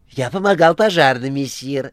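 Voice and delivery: very high, loud theatrical tale; Korovyev, mock terror. A narrator's reading. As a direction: ham up the panic. (Коровьев с наигранным ужасом)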